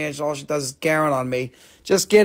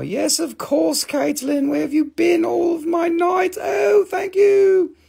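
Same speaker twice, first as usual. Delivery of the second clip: gushing greeting; 13 semitones higher, slower than usual. Acted. Gushing greeting to viewer Caitlin.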